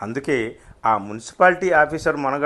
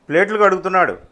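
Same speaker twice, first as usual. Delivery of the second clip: higher retort with a hint of glee; grinding teeth, half amused; 5 semitones higher, 4 dB louder, faster than usual. A narrator's reading. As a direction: spit it out with suppressed amusement. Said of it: "పళ్ళు కొరికిన శబ్దంలో కొంచెం నవ్వు కూడా". (పళ్ళు కొరుకుతూ కాస్త నవ్వుతో)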